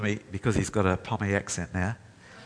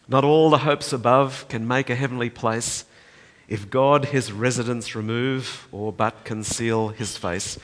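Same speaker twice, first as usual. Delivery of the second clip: ordinary talk, nothing marked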